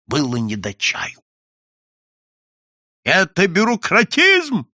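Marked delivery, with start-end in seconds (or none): 3.0-4.7 high, loud, drawn-out outcry; slow rising shout of protest; indignant shout. A narrator's reading. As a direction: cry out like an outraged customer. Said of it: "кричали ничего не понимавшие клиенты".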